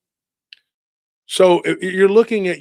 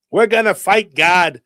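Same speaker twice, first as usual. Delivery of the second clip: loud, higher-pitched imitated declaration; mock proclamation; 5 semitones higher, 9 dB louder than usual. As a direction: shout it as someone else's battle cry. Acted quote of a defiant declaration.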